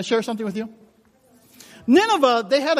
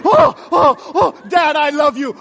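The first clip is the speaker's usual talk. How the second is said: emotional outburst; 8 semitones higher, 6 dB louder, slower than usual. Retold or imitated. Emotional moment with dad.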